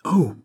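abrupt loud "oh"; sudden exclamation. A narrator's reading. Fouquet suddenly bangs the table, crying out. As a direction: cry out as if struck by a thought. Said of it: "S'écria tout à coup Fouquet".

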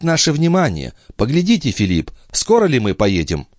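high-pitched female character voice; calm, authoritative high voice; composed young noblewoman. A narrator's reading. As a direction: speak for the sister in a high, firm voice. Narrator voices Andrea answering her brother.

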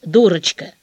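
plain reading voice

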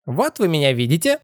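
high squealing peekaboo voice; crying out. A narrator's reading. Head bobbing from under the cap, gleeful high cry. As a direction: call out childishly in a squeaky pitch. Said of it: "выкрикивал он из-под кепки".

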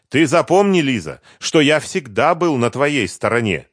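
loud, high, proud declaration; departing brother proudly reminds his sister of his loyalty. A narrator's reading. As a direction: puff yourself up as you say it. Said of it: "гордо сказал Семён".

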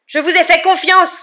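high, very loud, furious cry; indignant yell. A narrator's reading. Boy betrayed by his trusted professor, yelling indignantly. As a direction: hurl it out with indignation. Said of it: "hurla-t-il à Lupin, la voix frémissante d'indignation".

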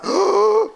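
sharp high startled gasp; mock gasp. Preacher acting out Satan's fright, mock gasp.

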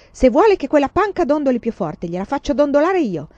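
loud, high defiance; angry yell. A narrator's reading. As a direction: yell back at the teacher in fury. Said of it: "urlò".

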